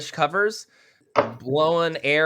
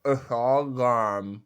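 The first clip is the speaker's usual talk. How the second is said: talking around a knife in mouth; muffled words past a blade; mouth full; 3 semitones lower, much slower than usual. Real, not acted. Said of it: "that's making me nervous".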